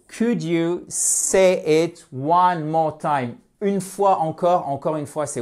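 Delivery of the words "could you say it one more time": each word stretched and separated; slow, deliberate English; slow teaching model. Shown as a demonstration. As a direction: dictate it carefully to learners. Teacher models phrase slowly.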